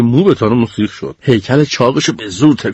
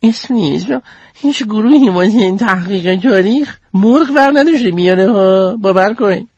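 put-on high girl voice, not quiet at all; a lightly voiced girl, meant to be hushed; quietly said; 7 semitones higher than usual. Goldie looks around and tells Sneeze, high girl voice. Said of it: "آروم گفت".

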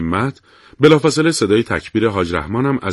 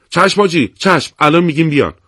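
eager reply; 6 semitones higher, 4 dB louder than usual. Acted. Basiji fighters answering commander eagerly.

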